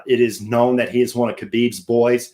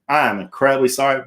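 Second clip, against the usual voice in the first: ordinary delivery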